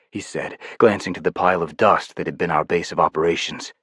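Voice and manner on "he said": ordinary talk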